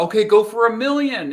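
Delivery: excited cheer. Retold or imitated. People urging him on.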